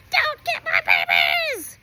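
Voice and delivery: shrill high screech, slow and loud; swooping magpie shriek. Retold or imitated. Magpie guarding its babies, high mock screech. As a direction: screech like an angry mother bird. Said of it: "the magpie ... just comes down and is like".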